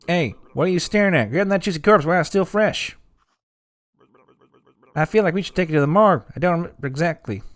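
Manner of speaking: high, fast cartoon voice; character voice. Voicing game character.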